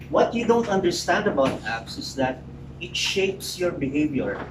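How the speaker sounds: ordinary delivery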